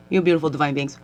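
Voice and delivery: normal talking voice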